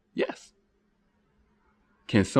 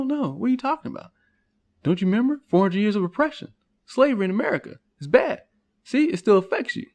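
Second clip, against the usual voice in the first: high, insistent, pushy voice; persuader; 7 semitones higher than usual. Acted. Manipulator insisting on oppression to the doubter, high and pushy. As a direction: lecture him with rising insistence. Manipulator pushing group identity.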